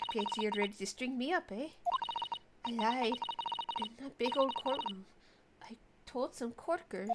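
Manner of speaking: put-on a light Irish accent, low and easy. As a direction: play her with an Irish lilt, lightly done. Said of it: "I'm stuck with an Irish accent".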